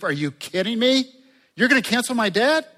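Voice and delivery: high, loud incredulous outburst; shocked disbelief. Pretend. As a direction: blurt it out like a man who can't believe his luck. Imagined servant's shocked reaction.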